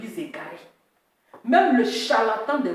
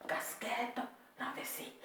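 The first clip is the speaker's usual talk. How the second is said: whisper; 16 dB quieter than usual. Shown as a demonstration. Streamer whispering his look to viewers, whispered. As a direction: whisper it, no voice at all. Repeats phrase then whispers it.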